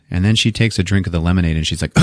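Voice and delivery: ordinary talk, nothing marked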